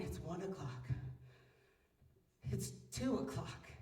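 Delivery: soft, drowsy clock check; sleepy. Retold or imitated. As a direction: mutter it half asleep. Awake at night checking phone.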